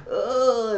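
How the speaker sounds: high loud hammed-up grunt; mock grunt. Mocking sex noise.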